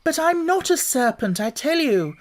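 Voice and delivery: lightly voiced young girl objecting; a hint of girlish Alice. A narrator's reading. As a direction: insist politely you're not a serpent. Alice protesting to pigeon.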